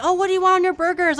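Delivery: loud, high, fast and bubbly; happy, chatty server. Acted. Shake Shack server chatting up a customer, exaggerated cheer. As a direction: overdo the friendly burger-counter greeting. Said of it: "he was so happy and making conversation".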